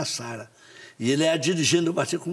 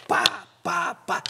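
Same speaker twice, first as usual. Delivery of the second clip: imitated blows; 8 semitones higher, much slower than usual.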